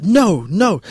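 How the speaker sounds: put-on high, loud child's protest; kid wailing no. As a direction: cry no like a stubborn toddler. Kid refuses again.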